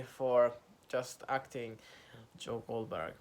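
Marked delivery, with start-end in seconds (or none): none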